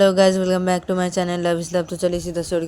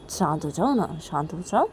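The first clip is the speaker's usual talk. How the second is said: quiet, level soothing request; calming plea; 4 dB quieter than usual. Acted. Reshma asking angry Kajal to calm down, soothing. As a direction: settle someone down gently.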